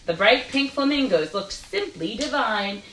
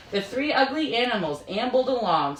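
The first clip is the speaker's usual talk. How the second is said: ordinary delivery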